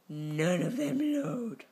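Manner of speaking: slow, whiny complaint. Real, not acted. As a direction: drag it out, annoyed. Frustrated drawn-out complaint.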